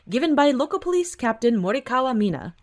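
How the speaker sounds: usual speaking voice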